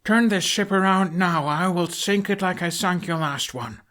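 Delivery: threatening a captain to turn the ship; strong threat. Acted. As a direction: bark the ultimatum across the deck. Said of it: "he announced as strongly as he could".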